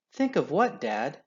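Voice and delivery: a cub asking dad eagerly. A narrator's reading. Eager cub asks father.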